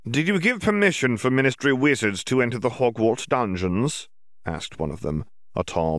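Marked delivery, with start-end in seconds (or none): 0.1-4.1 higher-pitched, lightly voiced official; lightly voiced demand for an answer, pitched up; questioning Auror. A narrator's reading. Auror questioning Riddle about dungeon permission, questioning.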